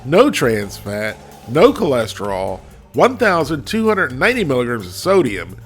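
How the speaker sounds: ordinary delivery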